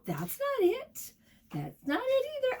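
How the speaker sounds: high, quick little voice of searching; lightly hunt through the page; playful searching. Pretend. Searching book for word.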